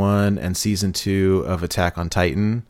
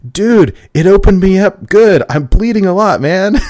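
mock macho guy, higher and louder; hammed-up tough guy bragging through the pain; quoting the host's boast about his bite, loud and high; imitating a macho dude; 10 semitones higher, 7 dB louder than usual. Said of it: "the quote was, he goes".

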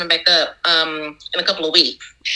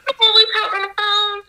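much higher, fast disbelieving question; retold question; faster than usual. Baker recalling her high-pitched question to the customer. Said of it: "And I said".

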